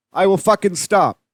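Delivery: ordinary delivery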